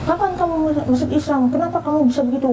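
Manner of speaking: high-pitched, rapid-ending mock scolding; retold scolding. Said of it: "saya dimarahin". (menirukan ibu memarahi, nada tinggi)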